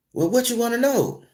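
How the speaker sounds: high, dismissive imitation of the office manager; mock a rude gatekeeper brushing him off; snobbish curt reply. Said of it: "she kinda told me what she told me in a snobbish way".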